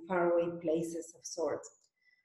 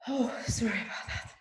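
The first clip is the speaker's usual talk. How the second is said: whispered aside; 4 semitones higher than usual. Real, not acted. Aside apology after a cough.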